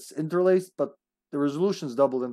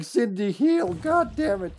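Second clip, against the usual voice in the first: high mock yelling at Cindy; imitating frustrated teammate; 8 semitones higher, 3 dB louder, slower than usual. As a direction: mimic a teammate losing patience. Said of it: "Even Lupka's going".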